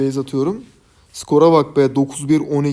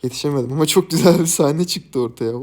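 ordinary talk

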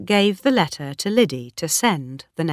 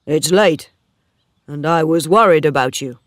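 louder, worried suitor's voice; lightly voiced Oak, concerned; 7 dB louder than usual. Oak meets Bathsheba walking home, lightly voiced and concerned. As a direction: lightly play a concerned farmhand, voice raised.

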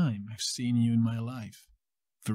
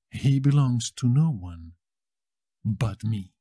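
normal talking voice

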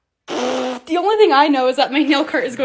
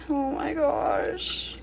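tense hushed gasp; 11 dB quieter, much slower than usual. Real, not acted. Tense moment while pouring.

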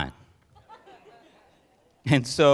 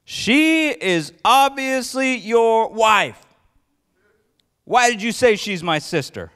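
yelling; 5 semitones higher, slower than usual. Acted. Abimelech yelling at Isaac over the lie, mock angry. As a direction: call him out, outraged king.

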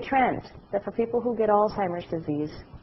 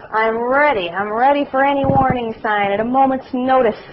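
louder and higher, ready for action; mock eager; 6 semitones higher, 8 dB louder than usual. Wife jokes about her checklist, loud and eager. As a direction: boast it like a watchful wife. Joking she's always watching husband.

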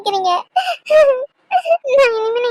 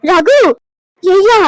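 put-on loud, low old woman demanding answers; a startled granny calling her grandson; alarmed grandmother; 2 semitones lower, 6 dB louder, much slower than usual. Grandmother to grandson about the bags, alarmed and loud.

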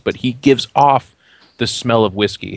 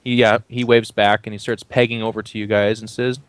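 ordinary delivery

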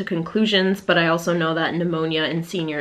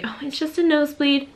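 higher, brushing it off as nothing; mock dismissive doubter; 8 semitones higher, faster than usual. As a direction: mimic the sceptics shrugging it off. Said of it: "some people are probably gonna be like".

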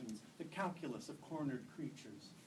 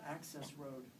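usual speaking voice

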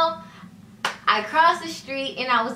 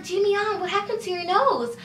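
higher, alarmed mother voice; a higher imitation of her mother asking about the nose; mock alarmed mom; 9 semitones higher than usual. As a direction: play the worried mom.

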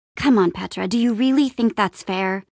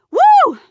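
very high, loud cheer; victory whoop; 8 dB louder than usual. Acted. Celebrating the Heckmouth takedown, triumphant whoop. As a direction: let out a piercing "woo".

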